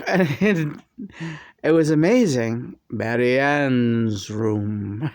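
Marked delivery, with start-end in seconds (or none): 2.9-5.1 slow, lower, otherworldly delivery; drawn-out, measured spirit line; John's channeled voice. Retold or imitated. Recalling John's first channeled words, slow and lower. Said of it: "John's voice comes through my mouth".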